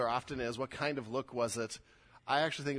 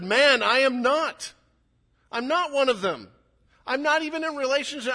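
high, loud, protesting denial; vehement denial; 11 semitones higher, 10 dB louder than usual. Acted. Peter denying his accuser that he is one of them, vehement. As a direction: push back hard, voice raised high.

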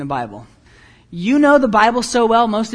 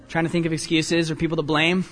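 normal talking voice

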